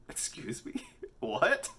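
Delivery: high, loud, laughing What; mock-scandalized surprise; shocked disbelief. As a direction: caught off guard and laughing. Shocked at student's question.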